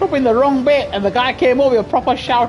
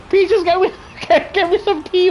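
laughing call; 6 semitones higher than usual. Real, not acted. Calling to partner for tea.

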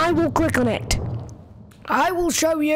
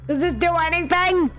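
usual speaking voice

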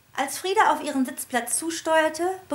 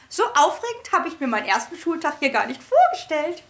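high, fluttery teacher voice; trilling lady voice; 6 semitones higher, 5 dB louder than usual. A narrator's reading. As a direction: coo it like a delighted lady. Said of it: "hohen Trillerstimme von Frau Schneckmeier".

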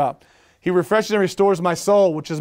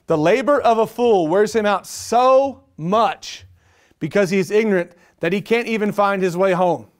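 scripture reading; 2 semitones higher than usual. Acted. Reads scripture.

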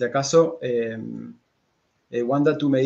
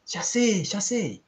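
high, eager exclamation; excited realisation; 9 semitones higher, slower than usual. A narrator's reading. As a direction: exclaim it as an idea strikes. Girl suddenly gets an idea.

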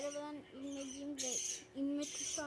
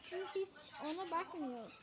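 normal talking voice

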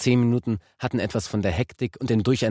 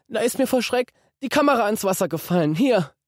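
put-on high, tearful boy's voice; almost crying it out; near tears; 4 dB louder than usual. A narrator's reading. Guilty boy confessing, near tears. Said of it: "Martin heulte fast".

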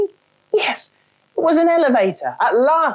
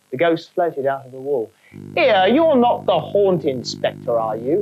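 chirpy high cockney ghost; a cheeky London trainee ghost; cockney spook; 4 semitones higher than usual. Acted. Spook character, cockney wording.

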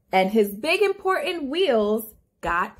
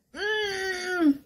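long, high, soft straining hum; effortful grunt; 5 semitones higher, 5 dB quieter than usual. A narrator's reading. Storyteller acting the truck pushing, straining hum.

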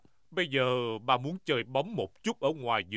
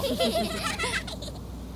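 high giggle. Acted. Laughing at Daddy's claim.

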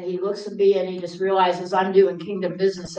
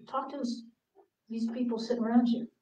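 quiet nudge; 9 dB quieter than usual. Retold or imitated.

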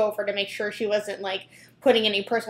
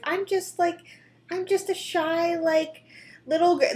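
mock shy girl; 7 semitones higher than usual. Reacting to Tati's video, mocking her as a shy little girl in a higher coy voice. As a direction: play her as a falsely timid little girl. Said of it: "Tati's like".

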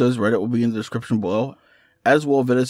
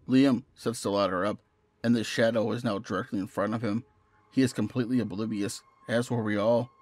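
measured, hushed storyteller, softer than chat; horror story narration; 6 dB quieter than usual. A narrator's reading. Narrator recounting finding shadowy Polaroids on the bed, measured horror narration. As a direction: read a creepy tale with steady restraint.

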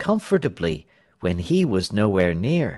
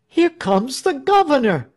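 high-pitched alarm call; frightened warning; 13 semitones higher, 4 dB louder than usual. A narrator's reading. Onlooker announcing the governor's approach, frightened. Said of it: "a frightened voice".

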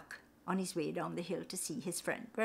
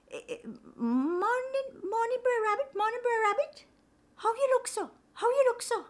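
much higher, sing-song greeting in island lilt; spider Anansi; 14 semitones higher than usual. Acted. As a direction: chirp the greeting, concerned. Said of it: "he stopped and he said".

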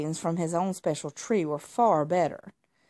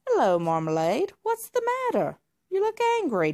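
much higher, friendly cat voice; friendly alley cat; 9 semitones higher, 4 dB louder than usual. Acted. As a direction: greet him kindly in a high voice. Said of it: "Dugbert was a friendly alley cat".

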